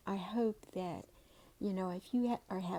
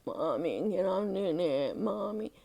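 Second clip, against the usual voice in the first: louder, strained mock of a child's damaged voice; a mother voicing how her sick girl used to talk; imitating daughter's damaged voice; 8 dB louder than usual. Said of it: "That's how she talked".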